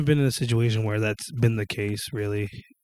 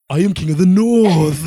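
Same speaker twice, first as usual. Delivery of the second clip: loud, high, exaggerated northern English proclamation; hammed-up Game of Thrones accent; 5 semitones higher, 7 dB louder than usual. Repeating Game of Thrones at the TV, exaggerated accent. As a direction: parrot the show grandly in a put-on accent. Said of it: "repeating what the fuck they're saying".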